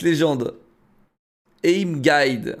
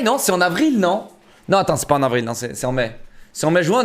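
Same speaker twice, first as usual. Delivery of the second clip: usual speaking voice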